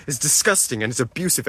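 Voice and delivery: usual speaking voice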